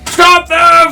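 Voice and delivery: high, urgent shouted command; guards' shout. Acted. Guards yell after the fleeing intruders, urgent shout. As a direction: call it out like guards in pursuit. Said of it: "from the guards".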